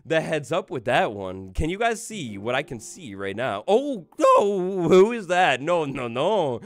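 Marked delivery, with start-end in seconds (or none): 3.7-6.6 startled surprise. Real, not acted. Unexpected sound on stream.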